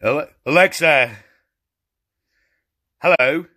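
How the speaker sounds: very low, loud calling voice; deep put-on voice. Shown as a demonstration. Trying another voice to wake Alexa, deep and loud. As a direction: call out in a booming deep register.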